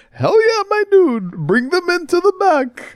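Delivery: very high, eager mock voice; crude AI voice. Car AI cheers Bond on, very high squeaky mock voice. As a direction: egg him on in a squeaky voice. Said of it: "is like".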